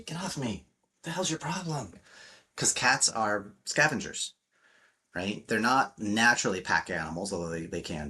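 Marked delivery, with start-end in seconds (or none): 0.0-1.9 lightly voiced cranky cat, higher and softer; a hint of an annoyed cat brushing you off; mock irritated cat; grumpy cat. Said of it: "you give them that kind of energy".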